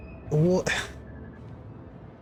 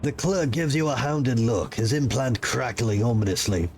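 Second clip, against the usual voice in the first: low, even narrator voice; story narration; 4 semitones lower than usual. A narrator's reading. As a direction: set the scene calmly. Game narration read aloud.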